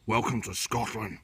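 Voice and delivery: a lightly done, deliberately bad Scottish accent; a mock Scottish Albert Finney line, hammed-up but only a touch; a jokey, half-hearted Scottish movie-quote accent. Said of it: "worst Albert Finney accent".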